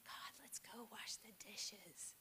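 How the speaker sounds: soft unvoiced whisper, slow; hushed little prayer over the dishes. Shown as a demonstration. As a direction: whisper a prayer while working. Example prayers acted out.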